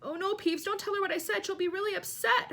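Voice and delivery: high-pitched, urgent pleading; frantic begging without a real whisper. A narrator's reading. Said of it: "Hermione whispered frantically".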